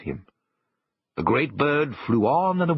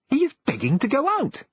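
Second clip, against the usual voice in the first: put-on high, bright child voice, much higher than the narrator; a high childish voice pleading for his toy dog; little boy's voice. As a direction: voice the small boy eagerly. Boy voiced in story narration.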